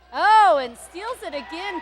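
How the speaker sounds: loud, slow burst at the steal; excited call. Real, not acted. Announcer calling a steal to the home crowd, excited. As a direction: shout the steal as it happens.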